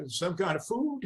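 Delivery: usual speaking voice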